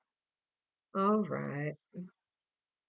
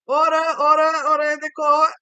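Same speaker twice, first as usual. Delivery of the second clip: booming, much louder judge voice, higher; 6 semitones higher, 12 dB louder than usual. Pretend. Tarot reader plays a judge calling court to order, booming. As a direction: bang the gavel and shout for order.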